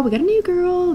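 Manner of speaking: high, delighted announcement; excited. Real, not acted. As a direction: announce the newcomer with glee. Excited about a newcomer.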